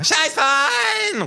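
long, very high, loud yell; kangaroo scream. Acted. Kangaroo joining in, drawn-out scream. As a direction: scream it out, stretching the word. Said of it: "ruft das Känguru".

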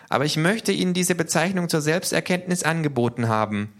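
high-pitched character voice; K, small creature voice. A narrator's reading. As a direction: speak high up as little K. (hohe Figurenstimme)

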